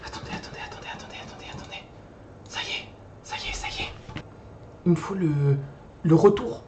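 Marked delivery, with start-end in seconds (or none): 0.1-3.9 very soft unvoiced whisper; barely audible, repeated whispering; hushed whisper. Real, not acted. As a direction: whisper hurriedly under your breath. Mutters while fixing the sound.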